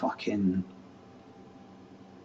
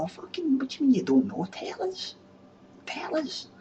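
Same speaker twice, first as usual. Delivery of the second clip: high, squeaky mock demand; whiny high voice begging to be told; high-pitched teasing plea; mock pleading fan.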